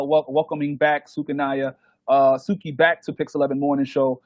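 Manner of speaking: ordinary delivery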